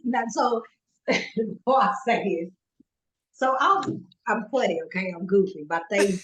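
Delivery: usual speaking voice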